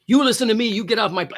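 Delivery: the captain shouting at the suspect, imitated loud; retold shouting. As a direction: bark the order the way the pilot did. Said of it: "He's shouting".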